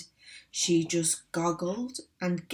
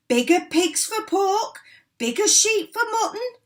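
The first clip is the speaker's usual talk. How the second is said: high excited rant; 9 semitones higher, 7 dB louder than usual. Acted. Cranky excitedly explaining.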